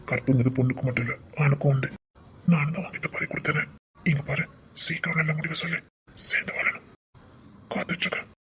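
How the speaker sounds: whisper. Acted. Husband whispering plea to wife.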